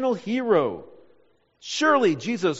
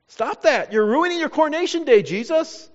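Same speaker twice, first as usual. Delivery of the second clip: acted protest, higher and louder as it goes; exasperated scolding; 6 semitones higher, 4 dB louder than usual. Preacher voices an onlooker rebuking Jesus on coronation day, in exasperated protest. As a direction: scold him in rising exasperation.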